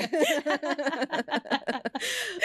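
a long, high giggling laugh; high giggle. Real, not acted. Laughing at the moving-in joke, high giggle. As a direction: let a high giggle roll out.

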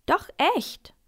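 put-on high, loud boyish protest; indignant. A narrator's reading. Boy contradicting the man in the seat, indignant. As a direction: call it out, outraged. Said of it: "ruft Max empört".

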